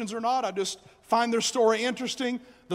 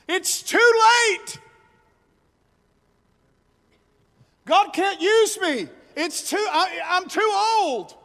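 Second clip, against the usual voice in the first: lightly voiced discouraged doubter, higher; voicing the lie; 8 semitones higher than usual. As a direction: act out someone who has given up, a touch higher. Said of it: "Number four".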